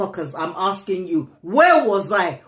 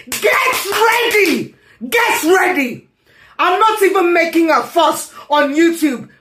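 furious shout; 7 semitones higher than usual. Real, not acted. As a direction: bellow it as a threat. Furious rant, threatening viewers.

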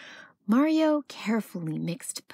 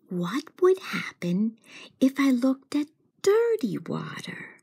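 whispered wondering; 5 semitones higher than usual. Acted. Mario wondering to himself.

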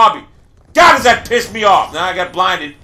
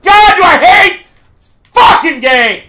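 real rage, high and loud; slow drawn-out angry yell; furious shout; 7 semitones higher, 6 dB louder than usual. Real, not acted. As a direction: scream your hatred at the game. Rage after another death.

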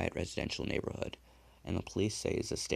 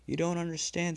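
high pleading; 11 semitones higher, 5 dB louder than usual. A narrator's reading. Crying killer pleads with the dead man, high. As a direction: pitch leaps up, desperate plea.

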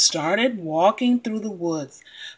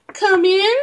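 wolf faking grandma; 9 semitones higher, 3 dB louder than usual. Acted. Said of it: "make her voice sound like grandmother".

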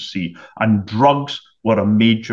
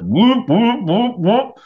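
imitated alarm whoop; 11 semitones higher, much slower than usual. Imitating the plane's alarm klaxon.

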